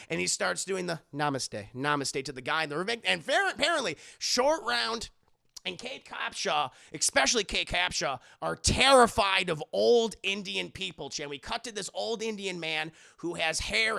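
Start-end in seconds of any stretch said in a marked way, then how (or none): none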